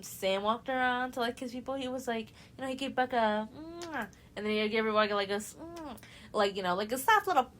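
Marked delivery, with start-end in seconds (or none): 3.6-4.1 higher, soft imitated kiss smack; kiss noise. Sam giving Becca a peck, soft imitated kiss sound. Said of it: "Me trying to make the fucking noise". 5.6-6.0 higher, soft imitated kiss smack; kiss noise. Sam giving Becca a peck, soft imitated kiss sound. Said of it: "Me trying to make the fucking noise".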